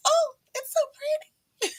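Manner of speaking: high, delighted squeal over the new gift; excited squeal. Retold or imitated. Retelling unboxing husband's gift, high excited squeal. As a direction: gush over it like a thrilled kid. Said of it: "I was so excited".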